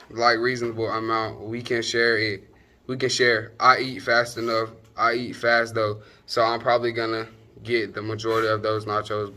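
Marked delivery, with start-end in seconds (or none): none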